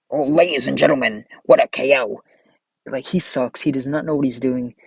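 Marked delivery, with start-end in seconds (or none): 0.1-2.2 lightly hammed announcer yell. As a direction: mimic a hyped ringside commentator, only mildly. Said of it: "He just screams".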